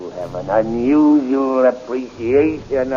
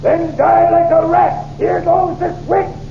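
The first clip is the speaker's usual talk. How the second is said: furious yell. Acted. Captain about to electrocute Flash, furious yell. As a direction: scream it at the rebels.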